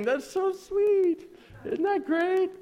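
high, drawn-out mock delight; sarcastic gushing. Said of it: "spiritual gift is sarcasm".

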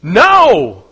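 loud no. Real, not acted. Preacher answering the congregation, a loud no. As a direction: shout it out.

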